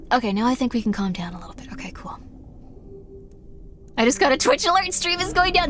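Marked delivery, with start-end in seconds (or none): 0.1-2.2 quick hushed whisper, calming down; fast whisper. Real, not acted. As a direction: hurry it out in a whisper. Calming the chat down.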